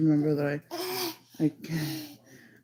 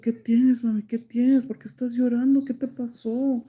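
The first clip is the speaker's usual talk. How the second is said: higher, louder imitation of a concerned mother; worried mom; 7 semitones higher, 5 dB louder than usual. Mom asks her crying child what happened, imitated worried voice. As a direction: retell mom's urgent fretting.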